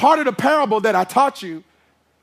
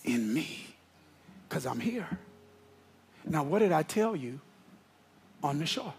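hushed preaching; 5 semitones lower, 8 dB quieter than usual. Acted. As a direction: lower and softer, intimate sermon voice. Preacher drops to intimate tone.

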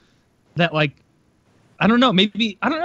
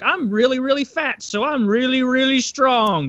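higher, slow dopey mock boast for "really strong"; mock deluded gym guy; 8 semitones higher than usual. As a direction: drag out "really strong" like a proud fool. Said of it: "the delusion that people have of".

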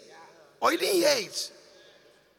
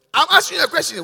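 louder and higher, pressing for an answer; demanding loudly; 5 semitones higher, 9 dB louder, faster than usual. Real, not acted. Pastor pressing silent congregation to answer, loudly. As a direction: call them out sharply.

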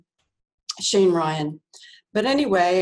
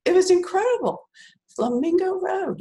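tearful; 11 semitones higher than usual. Real, not acted. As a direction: voice jumps much higher, choked with emotion on "Flamingo Road". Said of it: "I'm crying right now".

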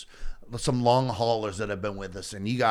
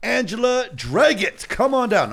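much higher and louder, a hammed-up game show host calling a contestant up; 13 semitones higher, 6 dB louder than usual. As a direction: belt the name like a TV host calling someone on stage. Game show style name call.

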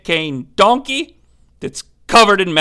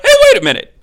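sudden objection; 6 dB louder, much faster than usual. Retold or imitated. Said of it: "He's like".

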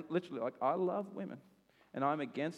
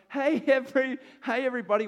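forced cheery greeting; 8 semitones higher, 7 dB louder than usual. Retold or imitated.

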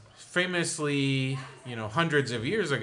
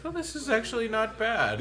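surprised colonist; 10 semitones higher than usual. Retold or imitated. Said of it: "they're like".

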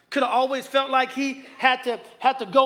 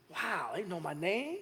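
much softer, slow wonder; quietly awestruck at being known; awed hush; 5 semitones lower, 11 dB quieter than usual. Retold or imitated. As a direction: hush it in amazement. Imagined awed thought.

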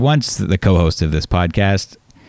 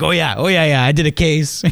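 higher-pitched imitation of an eager boss; lightly acted old boss recognizing something; boss in character; 8 semitones higher than usual. Former employee mimics his boss paging through the code, a bit of a character. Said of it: "use a little bit of a character".

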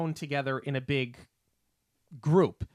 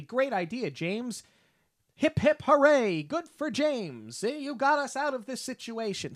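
much higher, sing-song cheer; mock cheering; 9 semitones higher than usual. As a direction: cheer him on like a cartoon bug. Insects cheering James, retold mockingly.

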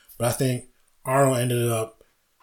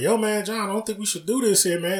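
higher, cautious imitation of D. Ray; imitating D. Ray; 9 semitones higher than usual. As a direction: play him nervous on the film set. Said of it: "Ray's like".

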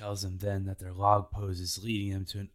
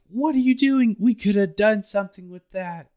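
much higher, loud exasperated yell; outraged shout; 13 semitones higher, 11 dB louder than usual. Retold or imitated. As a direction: scream at the captain in disbelief. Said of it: "Nami's like".

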